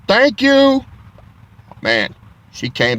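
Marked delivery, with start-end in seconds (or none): 0.1-0.8 much higher, louder cheery thanks; sing-song thank you. Retold or imitated. He thanks the officer walking by, high cheery thanks. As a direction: chirp a thank you at her. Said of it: "I said".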